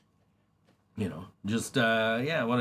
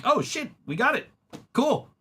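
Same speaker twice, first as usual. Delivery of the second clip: excited; 12 semitones higher than usual. Retold or imitated. Retold excited reaction.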